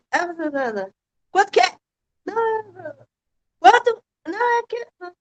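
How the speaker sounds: high, flustered stammer about the price; flustered dodging. Retold or imitated. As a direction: squirm away from naming the price, pitch rising. Acts her own flustered price talk. (gaguejando agudo, sem graça de falar o preço)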